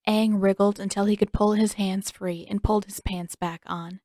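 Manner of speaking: usual speaking voice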